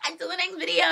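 high, small-voiced playful call. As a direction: echo the sign-off high but held back.

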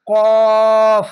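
কফ said heavy, loud and held; emphatic Arabic letter. Shown as a demonstration. Teacher demonstrating the heavy letter, loud and full. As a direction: boom out কফ with a thick sound. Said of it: "তিন আলিফ টেনে পড়বেন".

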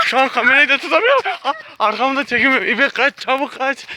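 fast, high, breathless alarm; urgent, rushed and scared; panicked. Real, not acted. Running from a dog.

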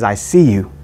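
lightly voiced Neo, low and sure; Neo's line. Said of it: "he says".

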